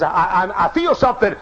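the heart can't hold it in; excited heart. Acted. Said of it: "But the heart says".

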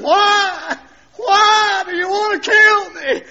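high, loud retold cry of protest; screaming and hollering. Wife begging the husband who threatened her, screaming. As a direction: holler it out as the terrified wife, drawn out. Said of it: "she began screaming and hollering".

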